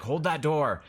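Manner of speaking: loud, high call across the room; yelled order. Acted. Game master as Barnaby yelling back at Jacob. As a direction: yell it as an urgent command. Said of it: "he yells back at Jacob".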